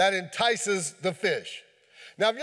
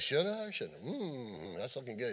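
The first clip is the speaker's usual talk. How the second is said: much softer and lower, tempted fish thinking; hushed fish musing; 8 semitones lower, 13 dB quieter than usual. Acted. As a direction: murmur it like a fish weighing the bait. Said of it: "he says".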